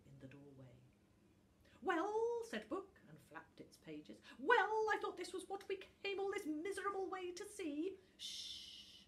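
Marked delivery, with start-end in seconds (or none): 1.8-8.0 much higher, louder, fast grumbling; impatient book. A narrator's reading. Book grumbles to little Tuft about the long way, impatient. 8.2-9.0 whispered hush. A narrator's reading. Little Tuft hushes Book before the sleeping dragon, whispered. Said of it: "little Tuft whispered".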